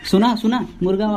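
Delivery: ordinary talk, nothing marked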